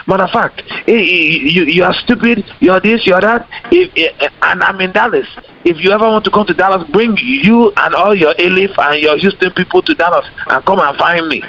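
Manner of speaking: put-on high, heated Nigerian accent; threatening Nigerian accent. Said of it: "I would say it in the Nigerian accent".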